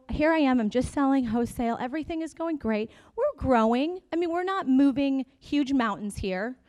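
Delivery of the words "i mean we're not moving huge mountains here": normal talking voice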